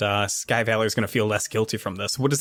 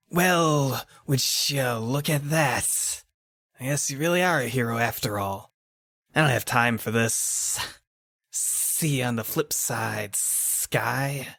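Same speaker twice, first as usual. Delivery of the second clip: slow, drawn-out thug voice; grudging thug taking his leave; sardonic beaten thug; slower than usual. Acted.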